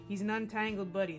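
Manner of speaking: ordinary delivery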